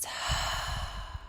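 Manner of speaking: long, soft audible out-breath; soft, relaxed exhale; demonstrated exhale. Shown as a demonstration. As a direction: let the breath go in a slow airy sigh. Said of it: "And exhaling into that nice ease".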